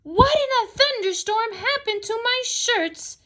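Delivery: high-pitched acted roar; outraged storybook outburst, voice pushed way up. A narrator's reading. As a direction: roar it at a very high pitch without much extra volume. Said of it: "Mister Rogers roared".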